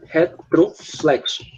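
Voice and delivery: Retroflexo said slowly, syllable by syllable; slow, careful correction. Shown as a demonstration. Teacher correcting a student, slowly.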